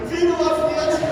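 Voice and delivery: high, loud festive yell; playful shout of celebration; yelled greeting. Retold or imitated. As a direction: shout it across the store. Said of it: "I yelled out in the middle of the store".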